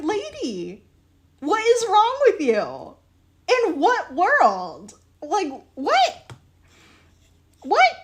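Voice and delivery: high-pitched, stretched-out mock confrontation; exasperated disbelief. Seller retelling how the shop lady baffled them, incredulous. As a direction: act out baffled outrage, pitch leaping up.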